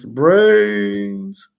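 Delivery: high, drawn-out zombie groan on brains; mock zombie moan. Shown as a demonstration. Host mimics movie zombies craving brains, mock moan. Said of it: "they like brains".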